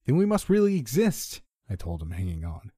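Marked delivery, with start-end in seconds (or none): 0.1-1.4 very high, loud shout. A narrator's reading. Narrator hanging on, to his friend, shouting. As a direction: shout it out while hanging on in a gale.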